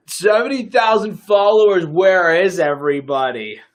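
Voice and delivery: half-joking exasperated yell; mock outraged shout. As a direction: cry out in mock disbelief at the number. Mock despair at dead stream.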